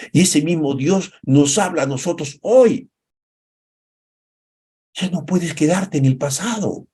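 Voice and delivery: impassioned high preaching, rising to a cry; fiery pastor building to a peak. Acted. Preaching at full force.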